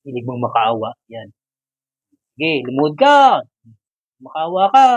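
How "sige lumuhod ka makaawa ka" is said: mock command. Ordering the toy to kneel.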